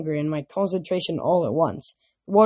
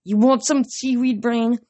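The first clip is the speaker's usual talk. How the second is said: taunt; 6 semitones higher, 3 dB louder than usual. A narrator's reading. Thalia taunting with spear.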